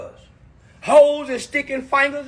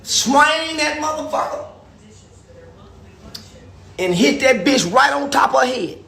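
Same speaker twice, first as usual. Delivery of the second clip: ordinary talk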